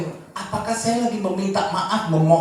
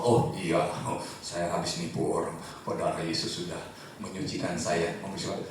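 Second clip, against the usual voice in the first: a lightly voiced careless believer, lower, with a chuckle; mock carefree; 10 semitones lower, 5 dB quieter than usual. Preacher mocking a careless believer, lower and offhand. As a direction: shrug it off as if sin costs nothing. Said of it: "Tenang-tenang aja".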